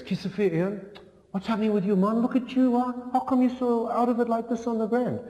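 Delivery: put-on high, lively French-accented friend. Security guard friend finds him on ground, high worried French-accented voice. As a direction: raise your voice high and sing-song it like a French-speaking friend.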